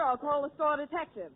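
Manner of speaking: usual speaking voice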